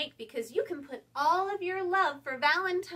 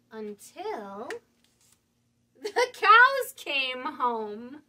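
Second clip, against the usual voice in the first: louder, slow drawn-out punchline; 9 dB louder, much slower than usual. Acted. Storyteller lands the joke on the children, slow and loud. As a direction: stretch it out for the joke.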